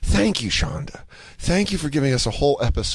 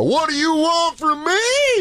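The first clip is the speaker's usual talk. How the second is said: mocking mimicry; 14 semitones higher, slower than usual. Son mocking his mom's complaint back at her, squeaky high. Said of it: "I go".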